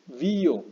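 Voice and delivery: vio split in two as VÍ-o, long stressed i. Shown as a demonstration. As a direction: lean hard on the i of vio. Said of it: "no estamos diciéndolo así".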